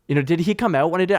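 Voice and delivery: normal talking voice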